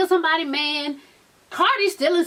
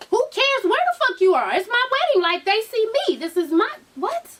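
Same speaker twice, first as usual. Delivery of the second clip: high-pitched, incredulous mock outrage; indignant bride; 8 semitones higher than usual. Yandy telling off Kimbella about her wedding, indignant. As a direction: act out an affronted bride, voice jumping up.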